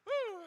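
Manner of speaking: high breathless whew out; winded whew. Real, not acted. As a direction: blow out air after exertion. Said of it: "While I catch my breath".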